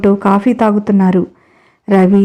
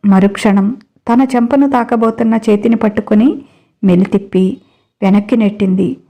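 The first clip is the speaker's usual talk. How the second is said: plain reading voice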